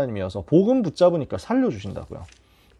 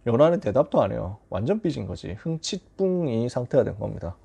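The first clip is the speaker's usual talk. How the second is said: ordinary delivery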